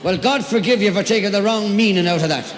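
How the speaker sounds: loud, teasing rebuke to the crowd; loud mock scolding. Real, not acted. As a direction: wag a finger at the audience, loudly. Scolding audience after laughter.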